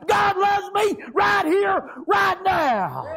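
high, loud, slow revival shout; full-force preaching. Acted. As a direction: raise the roof with joy.

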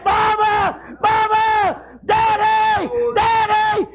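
put-on high shouted calls of a panicked child; hollering for parents. Child searching the house for parents, frantic hollering. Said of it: "and they holler".

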